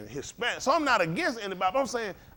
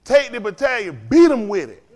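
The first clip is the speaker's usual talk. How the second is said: barked mock command, loud; loud mocking drill command; mock order; 9 dB louder than usual. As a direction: hammer each order like a drill sergeant. Preacher mocking training orders.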